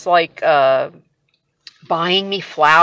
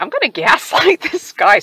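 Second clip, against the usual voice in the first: higher, louder, laughing through the plan; laughing scheme; 8 semitones higher, 5 dB louder than usual. Retold or imitated. Wife laughing as she plots against husband. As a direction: giggle as you hatch the scheme. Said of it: "I was kinda like".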